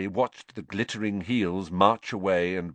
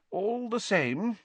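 slow, high, hedging objection; drawn-out, pitched-up demurral; demurring; 14 semitones higher, slower than usual. A narrator's reading. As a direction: object gently, drawing it out. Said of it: "demurred Fudge".

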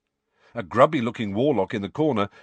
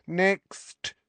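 bored clerk calling; 9 semitones higher than usual. Acted. Receptionist calling next.

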